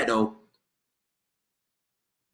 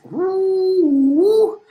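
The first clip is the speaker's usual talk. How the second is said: high woo hoots; 12 semitones higher than usual. Real, not acted. Host cheering the performance, high hooting woos.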